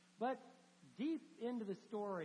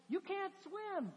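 slow high disbelief; 11 semitones higher, much slower than usual. Retold or imitated. Frodo's realisation, retold.

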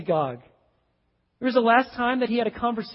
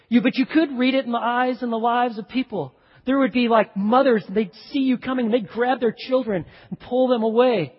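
raised, louder preaching voice; preaching at full force; 4 semitones higher, 5 dB louder than usual. Acted. Preacher painting the leper's rejection for the church, impassioned. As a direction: drive the point home to the congregation.

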